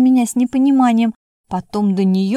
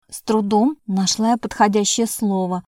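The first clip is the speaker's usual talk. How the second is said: ordinary delivery, nothing marked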